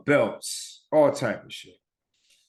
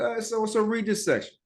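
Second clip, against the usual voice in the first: imitated teacher in a raised pitch; teacher calling on pupil; 10 semitones higher than usual. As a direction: put on a higher voice to call on someone. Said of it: "teacher calls on you".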